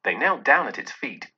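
ordinary delivery, nothing marked

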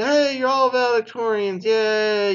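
hammed-up mock celebration, sarcastic; mock cheer. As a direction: sneer a fake congratulations. Mock school cheering everyone on.

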